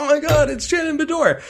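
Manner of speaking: high-pitched fan squeal in words; imitating an excited crowd, voice way up high; retold excitement. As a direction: gush like a thrilled fan spotting a star. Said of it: "just excited that she was there".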